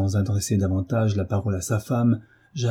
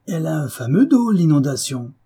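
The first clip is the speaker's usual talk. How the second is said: higher, louder scornful jeer; mocking sneer; 7 semitones higher, 4 dB louder than usual. A narrator's reading. Husband jeers at wife's flood excuse, scornful.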